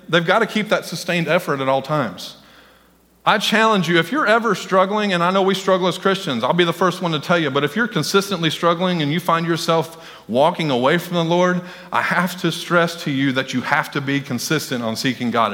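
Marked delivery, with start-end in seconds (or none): none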